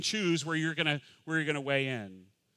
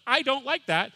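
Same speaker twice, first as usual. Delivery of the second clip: high, louder complaining dog voice; mock canine objection, pitched way up; voiced dog; 10 semitones higher, 5 dB louder than usual. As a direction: protest as a put-upon dog. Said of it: "and the dog goes".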